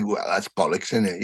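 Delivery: low, soft put-down; imitated dismissal. Father belittling his child's achievement, imitated dismissal. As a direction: imitate a dad quietly brushing off good news.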